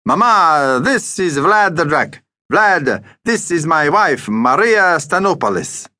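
put-on high, slow, Greek-accented introduction; proud Greek shopkeeper. Deli owner introduces his wife to Vlad, proudly. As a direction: present them grandly as a Greek shop owner.

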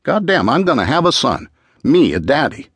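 high, swooping excited exclamation. A narrator's reading. Husband learning of the pregnancy, excited and high-pitched. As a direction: crow it like a thrilled father-to-be. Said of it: "How excited he'd been".

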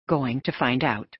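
plain reading voice

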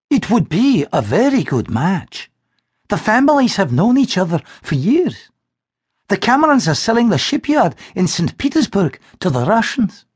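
a mother explaining the match; mother's voice. Acted.

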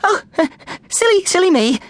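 high-pitched, giggly embarrassment; nervous giggles. A narrator's reading. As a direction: giggle nervously through the words. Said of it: "broken by her nervous giggles".

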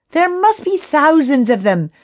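high-pitched groan of dismay. A narrator's reading. Billy faces thousands of rocks, groaning. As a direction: wail at the size of the task. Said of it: "groaned Billy".

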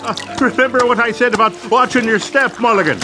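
taunting. Acted. Taunting Mulligan after his fall.

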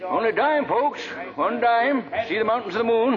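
barker's pitch. Acted. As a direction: hawk the telescope to the crowd. Pop pitching his telescope.

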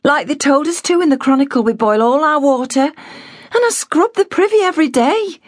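put-on a girl insisting she's careful; young girl. A narrator's reading. Girl's voice held.

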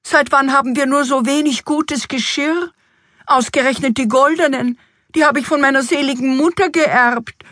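high-pitched, upset complaint; indignant queen. A narrator's reading. Queen misses her mother's golden plates, indignant. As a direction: keep the reproach high and aggrieved. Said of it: "rief die Königin aufgebracht".

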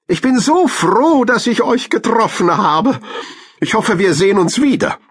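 very high-pitched cartoon animal voice; high and effusive, delighted to have met; glad squirrel. A narrator's reading. Squirrel tells hamsters she is glad, high delighted voice.

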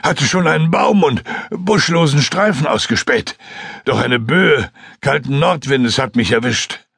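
excited pilot reliving the fall; Joskun recounting. Acted. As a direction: tell the crash story in a rush. Joskun recounts his crash.

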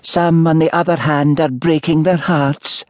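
high-pitched village spinster voice; pointed spinster. A narrator's reading. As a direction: needle him in a high, repetitive old-maid voice. Spinster accuses him of heartless flirting.

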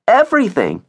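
high-pitched echoing promise; eager little kid. Acted. Augie backing up his sister, eager little kid. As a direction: chime in with a high, eager echo. Younger child joins the plea.

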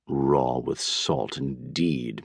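low, slow, very soft mutter. A narrator's reading. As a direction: mutter it darkly under your breath. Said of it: "muttered Doctor DeSoto".

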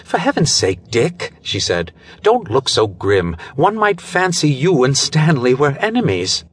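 lightly voiced nervous wife-to-be, half-laughing it off; uncertain young woman. A narrator's reading. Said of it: "laughed uncertainly".

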